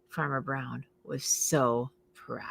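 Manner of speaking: soft whispered pride. A narrator's reading. Narrator whispering how proud Farmer Brown was. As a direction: hush it out tenderly.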